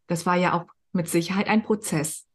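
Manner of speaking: ordinary talk, nothing marked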